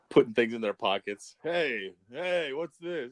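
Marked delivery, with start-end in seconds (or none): 1.4-3.1 twitchy slurred sniffing addict; jittery junkie asking what's this; crackhead voice. Acted. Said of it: "Is that your crackhead voice?".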